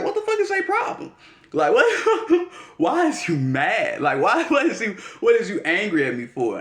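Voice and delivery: high, incredulous, laughing through it; baffled outrage. Retold or imitated. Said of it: "and it's like".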